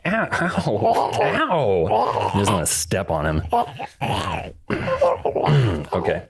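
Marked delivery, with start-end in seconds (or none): 0.0-2.3 high squeaky ow ow; tiny pained yelps. Retold or imitated. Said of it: "And I'm like". 3.5-5.9 high wheezy zombie groan; zombie noise. Pretend. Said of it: "Those were great zombie noises".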